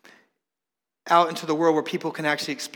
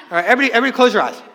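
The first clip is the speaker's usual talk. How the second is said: fast, louder call through laughter; laughing; 4 semitones higher, 5 dB louder, much faster than usual. Real, not acted. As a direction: laugh while rushing the instruction out. Laughing retake of the instruction.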